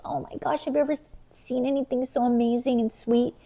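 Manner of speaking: a light mock-sweet gushing voice. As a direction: gush like a gullible fan. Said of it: "people are thinking, like".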